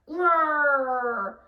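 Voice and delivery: loud growling roar; bear growl. Shown as a demonstration. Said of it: "growl like a bear".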